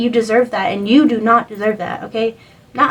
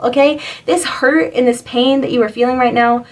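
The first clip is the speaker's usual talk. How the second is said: ordinary talk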